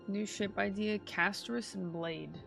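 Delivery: ordinary talk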